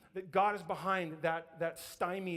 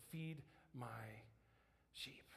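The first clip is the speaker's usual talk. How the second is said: very soft, low, spaced-out words; tender near-whisper, pausing before the last word; gentle charge; 8 semitones lower, 19 dB quieter, slower than usual. Acted. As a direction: give the charge in a hush. Jesus's solemn, gentle answer to Peter.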